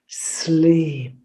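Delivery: whispered, hissing sleep; hissed whisper. A narrator's reading. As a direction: lull him with a whispered sleep. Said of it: "she hissed".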